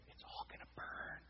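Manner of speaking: hushed, voiceless whisper; whispered aside. Real, not acted. Aside about the world burning.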